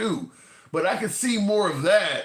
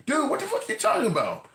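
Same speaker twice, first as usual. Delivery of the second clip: incredulous outburst; 11 semitones higher than usual. Retold or imitated. Incredulous locker room reaction.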